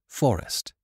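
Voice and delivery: usual speaking voice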